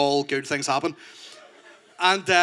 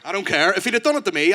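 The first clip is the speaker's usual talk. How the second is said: usual speaking voice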